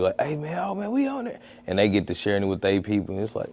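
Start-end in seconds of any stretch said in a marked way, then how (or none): 0.2-1.4 high-pitched, softly voiced delight; mock excitement. People spotting themselves on the show, mock excited. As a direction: imitate thrilled people in a small high voice. Acting out people seen on video.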